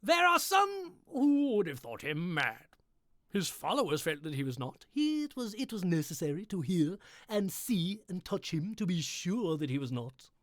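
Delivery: dramatic read-aloud opening; lifted narration, taken slowly at first; story narration. A narrator's reading.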